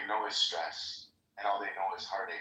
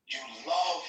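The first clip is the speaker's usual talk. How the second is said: African accent impression; 7 semitones higher, 3 dB louder than usual. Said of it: "next to this accent".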